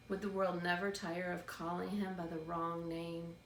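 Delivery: ordinary delivery, nothing marked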